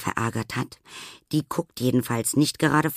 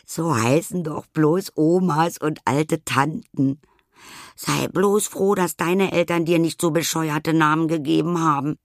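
amused teasing; 6 semitones higher, 4 dB louder than usual. Acted. Anna teases Nelly laughing.

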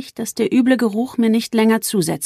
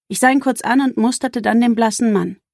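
plain reading voice

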